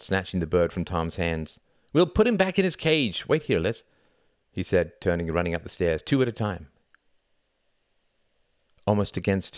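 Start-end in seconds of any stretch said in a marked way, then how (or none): 1.9-3.8 put-on high, loud child voice; bossy boy. A narrator's reading. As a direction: take charge in a raised boy's voice. Narrator voices boy taking charge.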